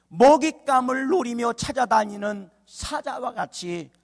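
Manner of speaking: loud, high, drawn-out sermon phrases; ringing, measured pulpit oratory; forceful preaching. Real, not acted. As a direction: drive each phrase home to the congregation.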